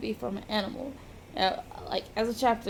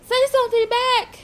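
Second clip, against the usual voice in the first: high, loud urgent plea; alarmed call; 12 semitones higher, 7 dB louder than usual. Acted. Girl urging an unresponsive injured man to answer, alarmed call.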